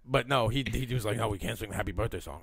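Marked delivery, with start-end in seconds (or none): 1.2-2.4 low, soft and fast, afraid of being heard; hushed warning. Retold or imitated. The cheap boss forbids the song, low, soft and paranoid. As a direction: hush it like the walls have ears.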